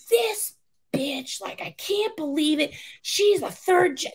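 high, shrill-pitched outraged rant; mock screaming rant. Recruiter ranting to senior about the woman, furious and high. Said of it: "just screaming up a storm".

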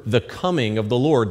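ordinary talk, nothing marked

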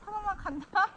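loud warning call. Real, not acted. Warning people before sliding.

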